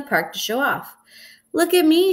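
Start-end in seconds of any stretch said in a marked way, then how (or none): 1.5-2.1 bright call, pitch jumping high; shouted. A narrator's reading. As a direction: call out to the whole park. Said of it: "she shouted".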